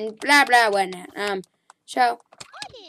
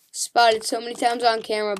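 usual speaking voice

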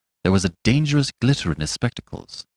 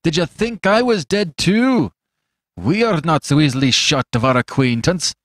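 bitter Fetz; 7 semitones higher, 3 dB louder than usual. A narrator's reading. Fetz taunting the doctor who thought him dead, bitter jeer. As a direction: jeer at him with old grudge.

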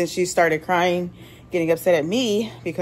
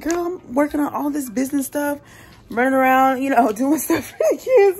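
exasperated; 6 semitones higher than usual. Retold or imitated. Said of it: "I'm like".

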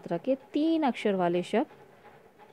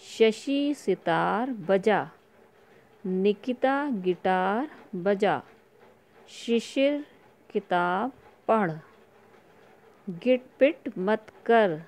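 very slow and clear, word by word; slow read-along; much slower than usual. Shown as a demonstration. Teacher reading slowly to children for them to repeat. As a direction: spell it out slowly so the kids can repeat. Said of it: "तो ये पढ़िए मेरे साथ।".

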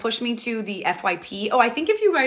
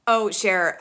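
usual speaking voice

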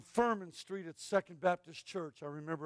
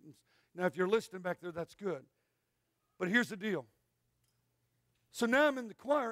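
normal talking voice

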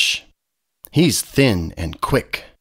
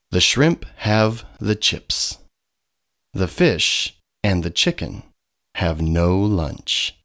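ordinary delivery

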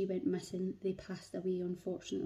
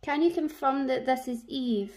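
calling out; 4 semitones higher, 7 dB louder than usual. Real, not acted. Calling to spirit, loud.

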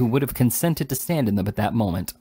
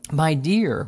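pleading, pitched up then falling; remonstrating; 5 semitones higher, 3 dB louder than usual. A narrator's reading. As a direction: protest gently to your wife. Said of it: "remonstrated Mister Pott".

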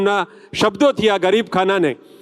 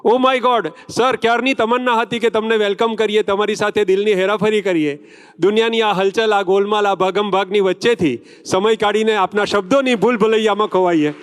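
higher-pitched, rolling announcer delivery; stage tribute; 3 semitones higher than usual. Acted. Host hailing the actor with his film titles, declaimed tribute. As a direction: declaim it as a showy welcome.

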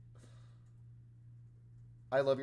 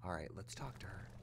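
whisper; 4 semitones lower, 9 dB quieter than usual. Real, not acted. Sneaking up to talk to character.

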